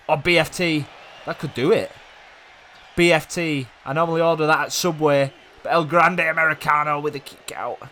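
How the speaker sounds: loud, high, thrilled call of the move; excited commentary. Real, not acted. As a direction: call it out like a hyped ringside commentator. Excited live match commentary.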